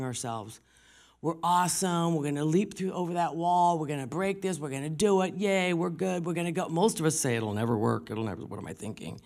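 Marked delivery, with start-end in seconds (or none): 1.2-6.7 higher, bouncy mock self-cheering; rally yourself with mock-triumphant energy; acted pep talk. Telling ourselves we can do it, hammed-up cheer. 7.4-9.2 deflated, dropping away at the end; acted defeated self-talk. Most of us talking ourselves down, acted discouragement.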